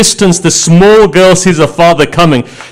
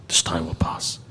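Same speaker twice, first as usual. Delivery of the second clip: whispered echo of the line before; 12 semitones lower, 19 dB quieter than usual. Acted. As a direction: breathe it out as a hushed whisper. Ali's words repeated as an echo.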